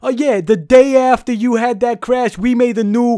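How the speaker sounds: hammed-up New York bartender, high and loud; a brash New York accent pushed up high and loud; bad New York accent. Bartender gushing to Sully, in a hammed-up New York accent. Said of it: "This is a terrible New York accent".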